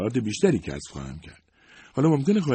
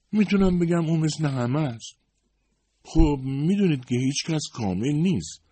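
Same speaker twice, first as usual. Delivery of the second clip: a higher, gentle secretary voice; lightly voiced Ms Barton; 8 semitones higher, 3 dB louder than usual. A narrator's reading. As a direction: play the loyal older secretary, modest and kind. (صدای کمی زیرتر خانم بارتون، منشی مهربان)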